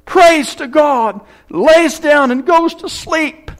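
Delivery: high, loud, drawn-out preacher's delivery; preaching at full force. Acted. Preacher telling Jacob's story, preaching at full force. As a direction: thunder the story to the congregation.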